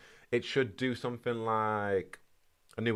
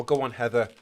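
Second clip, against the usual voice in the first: ordinary talk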